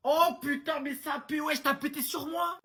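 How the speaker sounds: high, outraged shout. Acted. Roommate yelling at the other over the smell. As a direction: yell it in disgust.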